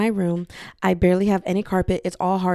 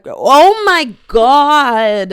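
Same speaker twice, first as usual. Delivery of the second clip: exasperated; 5 semitones higher, 10 dB louder, much slower than usual. Real, not acted. Exasperated loud drawn-out exclamation.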